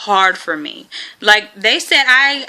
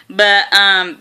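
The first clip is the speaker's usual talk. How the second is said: usual speaking voice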